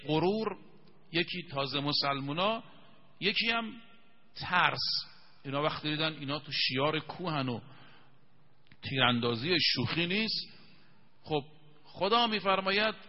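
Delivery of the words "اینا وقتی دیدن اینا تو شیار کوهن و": ordinary delivery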